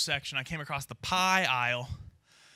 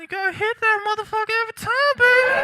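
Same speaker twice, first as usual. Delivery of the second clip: high, loud, fast street hustler voice; a wild crackhead pitching blackjack tips, way up high; hammed-up crackhead; 11 semitones higher, 7 dB louder than usual. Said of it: "crackhead giving advice".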